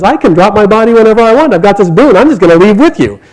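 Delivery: high, loud, excited rush; eager insistence. A narrator's reading. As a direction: push your case in an excited high voice. Bhishma insists on leaving too.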